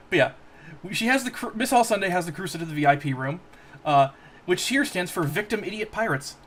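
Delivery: ordinary talk, nothing marked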